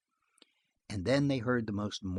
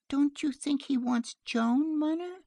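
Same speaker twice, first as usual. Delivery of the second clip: put-on pleading little girl; 13 semitones higher than usual. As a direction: ask wistfully in a small child's high voice. Child pleads with mother.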